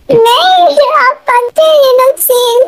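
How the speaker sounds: put-on high, small girlish voice, hurt and near tears; a little girl pouring out her hurt; childlike hurt voice. Little Tae telling the girl how hurt she is, high and childlike. Tae fears being scolded.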